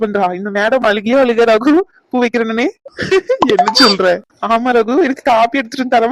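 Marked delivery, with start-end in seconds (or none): 2.7-3.5 quick high giggle. Acted. Ragu laughs while Priya teases Anu, giggle. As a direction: break into an amused giggle. Teasing about flowers for Anu.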